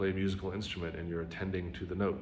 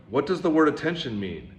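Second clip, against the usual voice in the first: loud, high, exasperated question; raised, frustrated plea; frustrated student; 7 semitones higher, 9 dB louder than usual. Acted. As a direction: demand an answer, fed up. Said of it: "In frustration".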